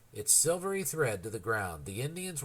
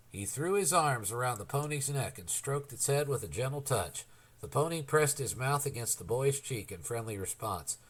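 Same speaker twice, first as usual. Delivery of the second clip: usual speaking voice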